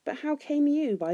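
measured, puzzled question; mild wonder at ordinary volume. A narrator's reading. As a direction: ask it in guarded wonder. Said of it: "But wonder overcame him".

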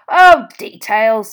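loud, slow, drawn-out DETAILS; dismissive. A narrator's reading. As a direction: wave away "details" with a booming, stretched delivery. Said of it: "waving the word away".

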